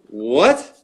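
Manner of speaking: loud what swooping upward; stunned, rising double-take; astonished. Retold or imitated. Songwriter learning the stranger produces music, astonished.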